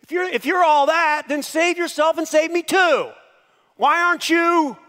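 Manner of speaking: high, loud jeering taunt; taunting criminal. Retold or imitated. Hellbound thief taunting Jesus from his cross, high loud jeer. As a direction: sneer up at Jesus from the next cross. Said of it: "I imagine him going".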